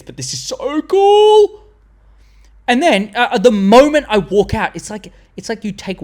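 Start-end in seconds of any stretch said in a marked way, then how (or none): 0.0-1.6 high, slow gush, stretched on the last word; imitated excitement. Cinema fans admit they love it, imitated excitement. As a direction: imitate fans won over, soaring up on cool. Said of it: "people were looking at each other go".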